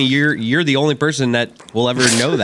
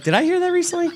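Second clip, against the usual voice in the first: much higher, vague puzzled voice; mock puzzled Bellucci; faster than usual. Co-host mocking Bellucci's vague memory, high puzzled voice. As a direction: float it up high, clueless.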